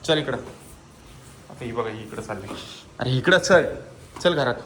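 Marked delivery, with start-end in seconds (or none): 3.0-4.5 loud, high call to the cat. Real, not acted. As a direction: call the cat in from across the terrace. Calling the cats home. (मांजरीला मोठ्याने हाक)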